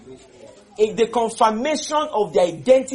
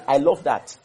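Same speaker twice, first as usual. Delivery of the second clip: ordinary talk, nothing marked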